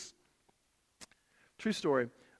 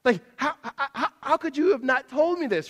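indignant question; 10 semitones higher, 7 dB louder than usual. Retold or imitated. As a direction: drag it out in disbelief. Confronting friend, disbelief.

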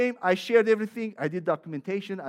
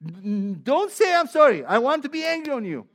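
higher, louder, fast mock indignation; mock indignant rant; 5 semitones higher, 7 dB louder than usual. Coworkers complaining they cannot stay angry, in mock indignation. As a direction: play the frustrated person who wants to stay angry. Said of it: "Because they say".

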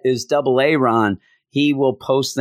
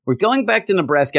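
excited; 8 semitones higher, faster than usual. Retold or imitated. Repeated, higher and faster.

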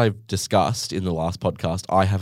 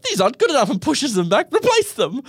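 much higher, imperious complaint; haughty demand; 4 dB louder than usual. Retold or imitated. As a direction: send the chips back like a demanding customer. Demanding replacement chips.

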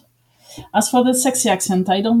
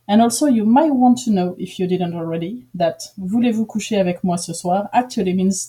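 ordinary talk

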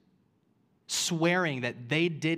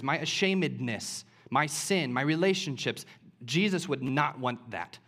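raised, emphatic sermon delivery; forceful preaching; 4 semitones higher, 4 dB louder than usual. Real, not acted. Preacher assuring sinners Jesus still wants them, forceful preaching. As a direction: press each phrase home to the congregation.

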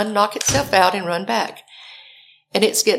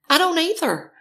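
high-pitched, indignant comeback; exasperated retort; 9 semitones higher than usual. Retold or imitated. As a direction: throw it back, thoroughly fed up.

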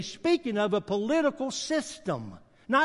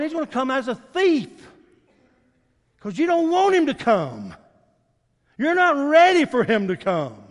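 loud, driving sermon at full force; fiery preaching; 4 semitones higher, 6 dB louder than usual. Acted. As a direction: preach it hard to the congregation.

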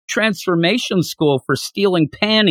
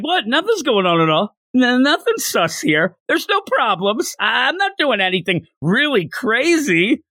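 flustered, higher voice; startled denial; 8 semitones higher than usual. Retold or imitated. Old master startled by the kids, flustered denial. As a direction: caught out, protesting too much. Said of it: "Kame Sennin, like, jumps, like, he started".

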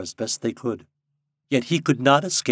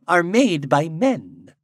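raised, louder, weighty pronouncement; solemn blackbird; 7 semitones higher, 7 dB louder than usual. A narrator's reading. Blackbird challenging Popopo on fashion, solemnly. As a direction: declaim it, drawing out key words. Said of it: "said a blackbird solemnly".

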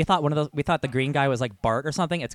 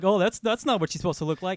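higher, mocking complaining fan; whiny fan voice; 7 semitones higher than usual. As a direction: mimic an upset fan griping.

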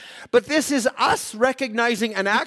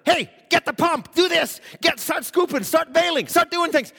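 higher, louder panicked orders; yelling disciples; 8 semitones higher, 3 dB louder than usual. Retold or imitated. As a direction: bark orders in a storm. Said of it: "they're yelling at one another".

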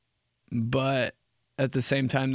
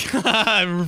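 loud, high mock laugh; exaggerated guffaw; put-on laugh; 6 semitones higher, 5 dB louder than usual. Reacting to dot dot dot text, mock laughing.

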